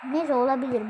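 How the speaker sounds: ordinary talk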